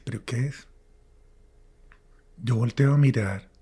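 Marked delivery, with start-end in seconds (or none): 0.0-0.7 whispered, breathless question; hushed uneasy whisper. Retold or imitated. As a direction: whisper it as if afraid to be heard. Eerie feeling of being watched.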